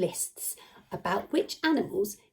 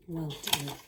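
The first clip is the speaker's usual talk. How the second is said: usual speaking voice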